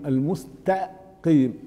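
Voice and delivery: "المستقيم" slowly split, "al-musta ... qim", thin t; light ta. Shown as a demonstration. Teacher modelling syllables, light ta. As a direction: pause in "المستقيم" after a pure ta. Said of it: "تاء".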